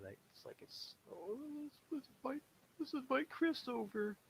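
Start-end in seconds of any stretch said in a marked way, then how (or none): 1.1-4.2 high, syrupy invitation; pitying friends. Retold or imitated. Friends pitying lonely Chris and inviting him, high and syrupy. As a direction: sound condescendingly generous.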